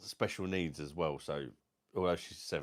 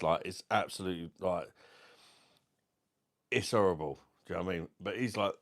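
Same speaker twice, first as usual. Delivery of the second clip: ordinary talk, nothing marked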